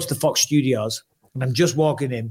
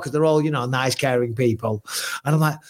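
normal talking voice

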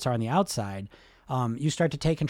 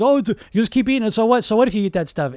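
loud, higher, rushed inner voice; dumbass voice; 6 semitones higher, 7 dB louder than usual. Acted. Coach mocking the voice that excuses overeating, loud dumbass voice. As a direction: blurt it out as the careless voice in your head. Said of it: "a dumbass voice that says".

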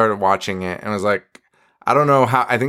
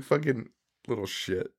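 a much softer, under-the-breath grumble; muttered aside; 13 dB quieter than usual. Real, not acted. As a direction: mutter it under your breath, half joking. Mock grumble about his son.

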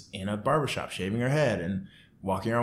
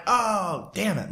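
mock pissed; 10 semitones higher, slower than usual. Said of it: "like, pissed".

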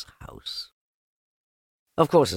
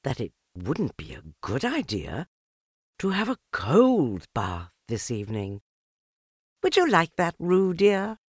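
thoughtful; 7 semitones higher than usual. A narrator's reading. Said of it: "in a thoughtful voice".

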